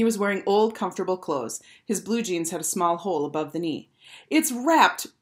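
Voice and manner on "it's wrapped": put-on high, excited boyish announcement; eager exclamation; exclaimed. A narrator's reading. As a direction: blurt it out, pitched up. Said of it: "Bradley exclaimed".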